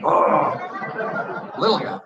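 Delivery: cooing. Retold or imitated. Affectionate quote of opponent.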